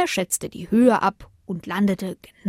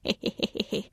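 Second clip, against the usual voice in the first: 1 leises tonloses Lachen; 8 dB quieter than usual. A narrator's reading. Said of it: "lachte der Brombeerstrauch".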